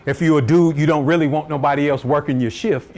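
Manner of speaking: normal talking voice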